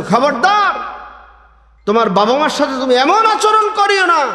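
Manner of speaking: high, forceful warning from the pulpit; fiery sermon warning; stern preaching warning. Acted. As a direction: warn the children sternly, full voice. Preacher voices God's warning.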